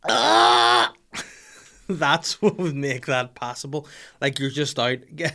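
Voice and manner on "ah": loud, hammed-up "ah" of ecstasy; mock orgasm moan. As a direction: moan as if the coffee were bliss. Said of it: "the orgasm sound".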